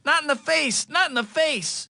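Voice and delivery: high-pitched mocking impression of a panicked thug; mock threatening thug. Spider-Man mimics the last guy who threatened him, mock panicked. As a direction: squeal it like a beaten bad guy pleading. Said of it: "the words of the last guy who threatened me".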